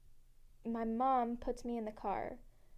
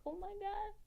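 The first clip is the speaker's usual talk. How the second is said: oh my God, much higher and airy; high mock gasp; 11 semitones higher, 4 dB quieter than usual. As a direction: squeak it in disbelief. Retold reaction to dad.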